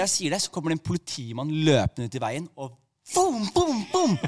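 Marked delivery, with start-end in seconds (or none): 3.1-4.2 high-pitched booming sound effect; comic imitated boom. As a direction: punch out short vocal booms. Imitated noise as policeman waves.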